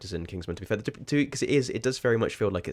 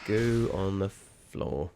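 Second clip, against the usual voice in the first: drawn-out repeat; much slower than usual. Acted. Repeating the goo remark.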